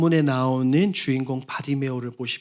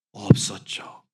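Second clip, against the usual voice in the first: whisper; 7 semitones lower, slower than usual. Acted. Sermon drops to whisper.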